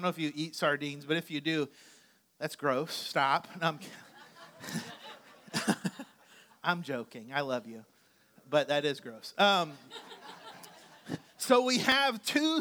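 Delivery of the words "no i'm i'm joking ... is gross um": ordinary delivery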